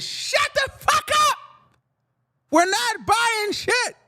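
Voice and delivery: very high-pitched yell; high, hammed-up furious shriek; screamed threat. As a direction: scream it as the armed daughter, drawn out at the end. Acted daughter pulling out a pistol.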